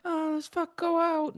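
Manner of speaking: high mock girlfriend voice; a high, airy girly voice. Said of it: "she's like".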